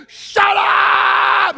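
screamed high and loud, in mid labour; furious shout. Retold or imitated. Wife in labour screaming at her husband, furious shout. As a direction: yell it at the top of your lungs. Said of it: "holy, righteous wife said".

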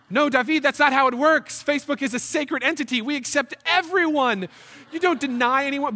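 hammed-up outrage at a coworker; mock scolding. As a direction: lecture him on sacred Facebook rules. Scolding David about Facebook.

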